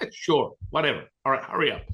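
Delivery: retold dismissive impatience. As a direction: act out her impatient go-ahead. Retold friend grudgingly agreeing.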